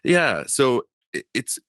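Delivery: ordinary delivery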